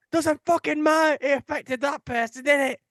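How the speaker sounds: high, loud furious scream; screaming girlfriend. Retold or imitated. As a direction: scream the point at him. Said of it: "she's screaming".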